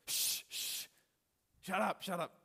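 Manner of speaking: acted-out crowd silencing someone; shushing. The people hushing the blind man, shushing. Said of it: "Jesus shushed them".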